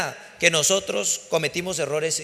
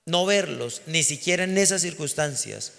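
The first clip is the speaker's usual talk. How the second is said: ordinary talk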